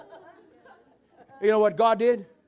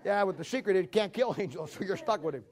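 normal talking voice

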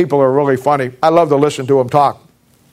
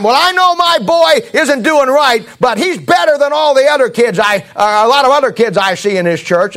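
imitating a mother; 7 semitones higher than usual. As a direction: raise the pitch and plead like a mother making excuses. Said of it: "Lady said to me".

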